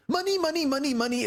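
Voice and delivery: sing-song it like a dimwit strutting; stupid voice. Retold or imitated. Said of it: "in that stupid voice".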